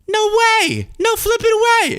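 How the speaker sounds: squealed falsetto "no way"; mock disbelief. Parody Trump squeals at donors in falsetto disbelief. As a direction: shriek it in sky-high mock outrage. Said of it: "and I got up and I said".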